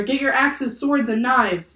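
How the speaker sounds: rally the crew with a shout; yelled order. A narrator's reading. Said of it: "yelled another".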